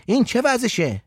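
mildly protesting man's voice; intervening man. A narrator's reading. Abbas telling the father to let the boy talk, protesting. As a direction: step in to let someone speak.

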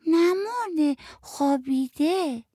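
put-on high, gentle little-kid voice; a small child quietly insisting; child speaking softly. A narrator's reading. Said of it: "آتی یواش گفت". (صدای نازک و آرام بچه)

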